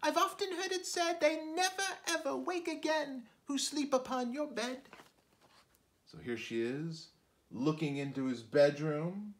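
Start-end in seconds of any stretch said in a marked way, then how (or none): 0.0-4.9 very high small fly voice; a tiny creature repeating a warning; little fly voice. Acted. Fly warning the spider she knows his bed, high small voice. Fly continues her reply.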